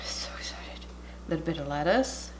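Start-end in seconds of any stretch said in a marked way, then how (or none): 0.0-0.8 low breathy hush; hushed whisper at the food. Real, not acted. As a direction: whisper so you don't disturb anyone.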